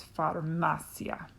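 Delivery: "farmacia" with a strong "ma" and short "cia"; next-to-last syllable stressed. Shown as a demonstration. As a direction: correct it gently to far-MA-cia. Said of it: "the next to last syllable gets the emphasis".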